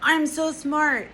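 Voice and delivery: high, loud, slow self-satisfied voice; mock smug director. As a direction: drag it out like a smug genius. Mocking the director's ego.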